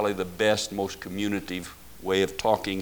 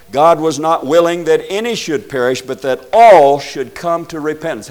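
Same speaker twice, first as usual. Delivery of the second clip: loud, raised preaching voice; emphatic scripture reading; 5 semitones higher, 10 dB louder than usual. Real, not acted. Preacher quotes Second Peter to the congregation, loud and emphatic. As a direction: drive the verse home, swelling on ALL.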